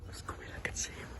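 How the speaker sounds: breathless secret whisper. Acted. Zacchaeus hiding in the tree, whispering. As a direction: hush it so nobody hears.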